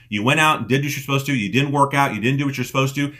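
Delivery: usual speaking voice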